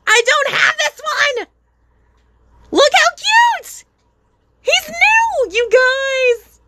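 high-pitched, thrilled squeal; so excited. Real, not acted. Vlogger showing viewers a brand-new cute mini, excited squealing. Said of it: "I'm so excited".

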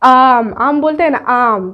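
आम with the आ pulled long; long drawn आम; stretched long vowel. Shown as a demonstration. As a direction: drag out the आ in आम. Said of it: "थोड़ा लंबा खींच कर हम लोग बोलते हैं".

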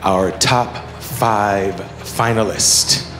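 slow, suspenseful reveal; pageant host. Acted. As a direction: draw out the suspense.

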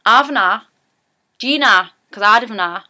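avna, said loud and broad Geordie. Shown as a demonstration. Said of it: "would probably even make it more slang than that".

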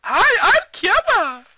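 very high, loud, slow squeal; dog's voice. Acted. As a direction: speak for the dog in falsetto. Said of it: "This is my dog, Kiowa".

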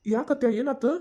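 worried, questioning lift; concerned question. Acted. Nephew asks aunt what happened.

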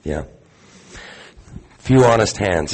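ordinary talk

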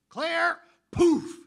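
very high, loud yelp of a name; startled outburst. Retold or imitated. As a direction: blurt it out from across the room. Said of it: "over in the corner I hear".

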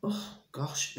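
a hot, itchy sigh under the breath; real discomfort, whispered; whispered aside. Real, not acted. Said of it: "I'm sweating".

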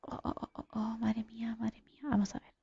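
barely audible, whispered muttering; hushed dismay. Real, not acted. Gamer to self on seeing trouble, hushed dismay. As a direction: whisper it under your breath in dismay. Danger sighted in the game.

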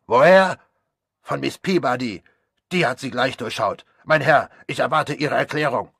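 loud, high indignant demand; angry shout. Acted. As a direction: bark out the answer in outrage. Doctor still furious. (empört und laut, mit hoher Stimme)

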